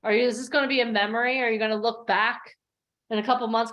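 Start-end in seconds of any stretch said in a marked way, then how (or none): none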